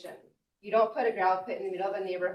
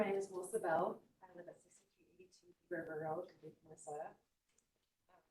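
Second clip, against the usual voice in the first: soft-spoken, much quieter statement; hard to hear; 10 dB quieter than usual. Real, not acted. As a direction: speak too softly for the room to hear. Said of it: "It's hard to hear you".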